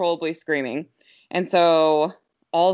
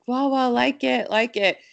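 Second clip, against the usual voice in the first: put-on toddler voice; 7 semitones higher than usual. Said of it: "she's like".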